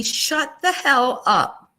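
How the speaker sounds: slow, drawn-out and high; stunned disbelief. Real, not acted. A vlogger stunned by a service dog price, drawn out and slow. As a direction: let the shock stretch every word.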